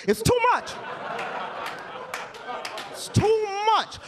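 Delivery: high-pitched comic exasperation; exclaimed protest. Real, not acted. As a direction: cry it out as a fed-up verdict. Comic protest, repeated for effect.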